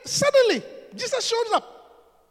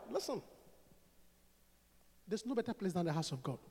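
ordinary talk, nothing marked

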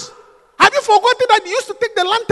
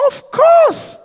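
emphatic reply; 11 semitones higher, 6 dB louder than usual. Retold or imitated. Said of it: "I said".

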